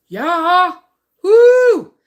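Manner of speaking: high, loud gleeful whoop; excited cheer. Retold or imitated. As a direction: cheer like you just heard great news. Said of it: "And I'm like".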